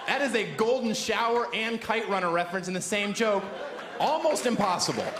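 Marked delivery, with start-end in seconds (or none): 4.0-5.1 proud boast. Shown as a demonstration. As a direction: crow it to the crowd. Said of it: "I pull it off because I care".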